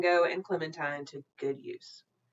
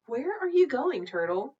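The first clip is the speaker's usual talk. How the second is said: lightly voiced mother; 8 semitones higher, 6 dB louder than usual. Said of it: "she asked".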